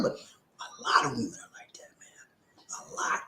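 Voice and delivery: whispered aside. Real, not acted. Host whispering to his viewers in confidence. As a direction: lean in and whisper it.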